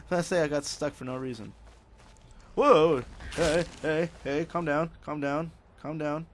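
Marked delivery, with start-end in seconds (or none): 2.5-6.3 loud high "whoa", then urging calm; startled yelp easing into talking someone down; calm them down in a hurry; startled calming plea. Real, not acted. Startled by attackers in game.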